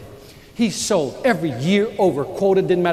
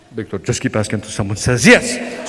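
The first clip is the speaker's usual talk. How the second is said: much lower, calm Spanish-tinged voice; deep suave mentor; 11 semitones lower than usual. Retold or imitated. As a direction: speak low and smooth like the mentor. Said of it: "real deep voice".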